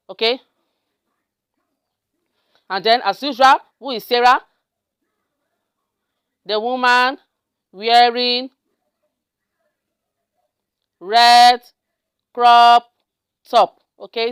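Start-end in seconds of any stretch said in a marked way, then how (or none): 6.5-13.8 halting word-by-word typing voice; drawn-out words, long pauses between; slow dictation. Real, not acted. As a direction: hold each word while typing it.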